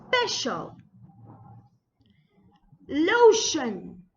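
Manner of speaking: each word from "Special" to "Gracious" separate, drawn out, sh prominent; model pronunciation. Shown as a demonstration. Teacher modelling lesson three words, careful emphatic enunciation. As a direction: dictate "Lotion", "Mansion" and the rest with emphatic stress and falling endings. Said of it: "see the correct pronunciation".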